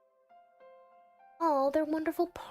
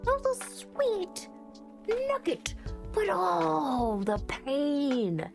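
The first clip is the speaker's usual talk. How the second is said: high, drawn-out coo sliding down; pained gushing; 3 semitones higher, slower than usual. Real, not acted. Player lamenting a bittersweet ending, high pained gushing. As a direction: gush as if it hurts sweetly.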